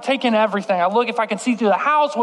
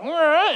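cat meow; 8 semitones higher than usual. Retold or imitated. Cat sound behind door.

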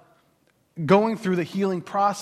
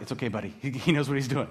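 lower, softer, soothing mock reassurance; calm reassurance; 6 semitones lower, 5 dB quieter, faster than usual. Peter calming anxious Jairus, lower and soft. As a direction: pat him on the back with the words.

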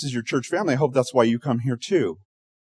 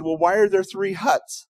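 puzzled rescuer; 8 semitones higher than usual. Acted.

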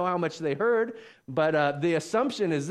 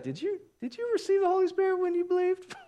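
very high, squeaky question; comic high-pitched squeak of a voice; put-on questioning impression. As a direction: ask it way up in falsetto. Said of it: "Maybe he said it like that".

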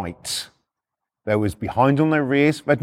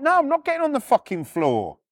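high, indignant refusal; retold heated refusal; 10 semitones higher than usual. Repo man refusing copper's order, heated. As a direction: refuse the order point blank, voice raised high.

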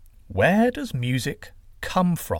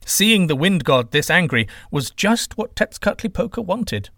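ordinary delivery

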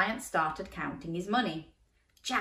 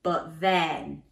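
ordinary delivery

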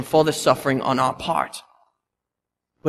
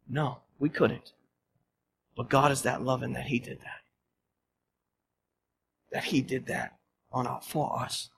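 hushed; 5 semitones lower, 12 dB quieter than usual. Real, not acted. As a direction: let it fall to a quiet hush. Answer to rhetorical questions.

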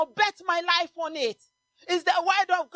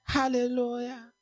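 ordinary talk, nothing marked

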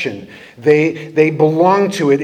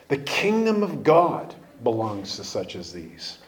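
quiet emphatic repeat; 6 semitones lower, 7 dB quieter than usual. Shown as a demonstration. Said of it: "For the kingdom of God belongs to such as these".

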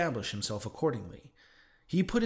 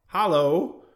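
loud, higher hailing shout; crying out; 4 semitones higher, 9 dB louder than usual. A narrator's reading. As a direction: hail someone who is walking away. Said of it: "cried stationary Elijah".

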